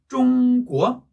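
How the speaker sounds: "쫑꾸어" with high held "쫑", then rising "꾸어"; first-second tone. Shown as a demonstration. Teacher joining the syllables, "쫑꾸어" slow, level then rising. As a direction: slowly hold "쫑" high, drop and lift "꾸어". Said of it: "이런 톤이에요".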